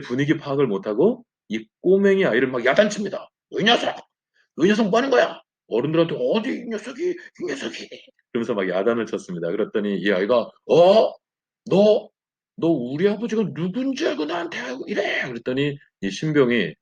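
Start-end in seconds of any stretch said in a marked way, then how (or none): 3.5-7.9 higher, scolding the brat; scolding recruit. Retold or imitated. New recruit scolding the colonel's boy, stern. 10.7-15.2 put-on much higher, indignant child's voice; a spoiled boy puffing himself up; bratty kid. Colonel's son threatening the recruit, indignant child.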